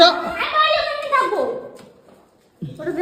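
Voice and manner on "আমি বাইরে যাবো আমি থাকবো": high, raised-voice protest; loud protest. Acted. Arguing with the man over going outside, loud protest. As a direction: protest loudly, pitched well up. Heated argument over a parcel.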